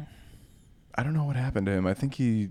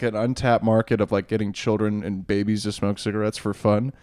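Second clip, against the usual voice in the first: usual speaking voice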